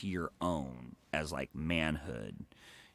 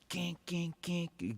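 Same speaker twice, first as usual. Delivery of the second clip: punch noises; 12 semitones higher, much slower than usual. Retold or imitated. Punching sound effect.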